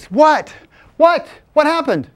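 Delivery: high, urgent, punchy questions; startled questioning. Retold or imitated. Friend reacting to Jacob's cry in the car, startled. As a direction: fire back in acted alarm.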